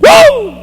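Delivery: sudden high excited whoop; short jubilant preacher's whoop. Real, not acted. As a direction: let out a high cry of praise. Preacher's exclamation, very high pitch.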